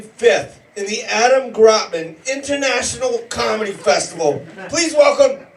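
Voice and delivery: big hype intro yell; loud club-host welcome; announcer shout. Real, not acted. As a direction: call the comic up like a ring announcer. Host announcing comic to crowd.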